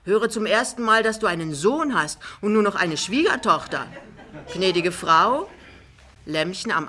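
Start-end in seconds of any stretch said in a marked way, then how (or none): 0.0-5.5 loud, higher-pitched amazement; boisterous astonished giant. Acted. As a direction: boom it out in lively surprise. Jachmann staunt, begrüßt Lämmchen. (laut und lebhaft erstaunt)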